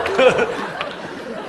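airy high burst of laughter; breathy laugh. Real, not acted. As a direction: laugh out in disbelief. Laughs at the dolphin act.

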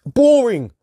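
high, loud, falling boring; boring stretched out high and loud; drawn-out boring. Shown as a demonstration. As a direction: sneer the word boring. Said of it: "That's how I put it".

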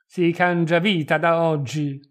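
loud, grim declaration; forceful, dark-tempered proclamation; grim announcement. A narrator's reading. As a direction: lay down the law loudly. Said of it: "fosco e ansante".

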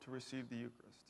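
ordinary delivery

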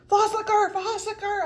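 hammed-up finger-pointing taunt; mock accusing yell. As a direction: point and call her out, playground style. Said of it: "he yelled at me for licking my floss".

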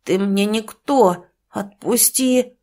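put-on slow, defiant child's voice; a drunk boy pulling free; lightly voiced boy resisting stepfather; struggling boy. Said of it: "вырывался Егор".

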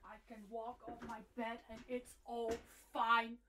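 ordinary talk

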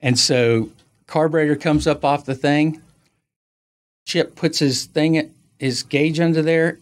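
ordinary talk

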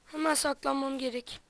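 ordinary talk, nothing marked

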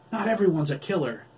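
high, loud angry retort; angry growl. A narrator's reading. Corny growling at Adam in anger. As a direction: snap back in anger. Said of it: "Corny growled".

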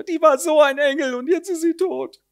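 high, wailing hammed-up grief; a landlady overplaying her loss; mock grief. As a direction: wail it with mock sorrow. Landlady mourns foster daughter.

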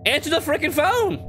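high, frantic demand; high-pitched impatient outburst; exasperated yell. Real, not acted. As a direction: yell at someone who won't pick up. Yells at unanswered in-game phone.

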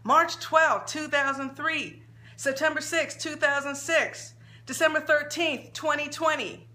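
mild mock shouting of dates. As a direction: call the dates out like a wailing mother. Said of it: "shouting out dates".

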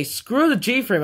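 usual speaking voice

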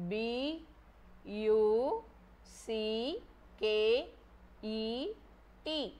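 each letter of "B U C K E T" said alone, softly, with pauses between; spelling aloud. Shown as a demonstration. Teacher spelling a word for children, letter by letter. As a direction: dictate the letters one by one. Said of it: "What is the spelling for bucket?".

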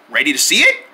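ordinary talk, nothing marked

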